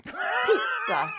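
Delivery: hammed-up Italian cry, high and loud; Italian accent. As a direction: Pizza belted like a stage Italian. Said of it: "I need to practice my Italian accent".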